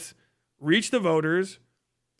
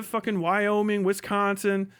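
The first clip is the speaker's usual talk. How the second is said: ordinary delivery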